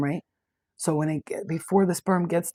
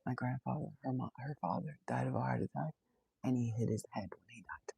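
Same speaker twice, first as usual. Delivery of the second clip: softer, hushed whisper, lower; hushed confiding; 4 semitones lower, 11 dB quieter than usual. Retold or imitated. Hairdresser confides to the psychic in a hushed whisper. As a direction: lean in and whisper the family secret. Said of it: "she was like".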